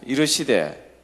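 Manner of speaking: normal talking voice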